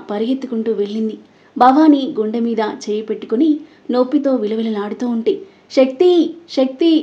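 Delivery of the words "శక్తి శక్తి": high, loud desperate yell of a name; screaming for help. A narrator's reading. Wife screaming for husband as mother-in-law collapses, panicked. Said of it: "అని గొంతు చించుకొని అరిచిన".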